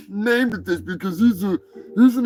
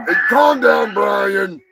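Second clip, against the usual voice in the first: calling out; 8 semitones higher, 4 dB louder, slower than usual. Real, not acted. Calling out to chatter Brian.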